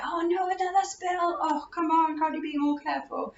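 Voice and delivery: high-pitched, exasperated grumble at a spill; a tired parent's mock groan over another spill; exasperated mum. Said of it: "I was like".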